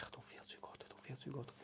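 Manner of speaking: barely voiced, fading whisper; a weak, breathless whisper of feeling unwell. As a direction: whisper it like you're feeling faint. Retelling feeling ill at work.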